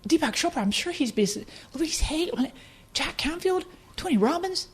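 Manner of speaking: high-pitched astonishment, building to a loud burst; thrilled disbelief, climbing in pitch; excited realization. Retold or imitated. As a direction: build to a loud, laughing squeal of delight. Imagining famous authors as new neighbours.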